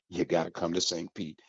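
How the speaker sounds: ordinary delivery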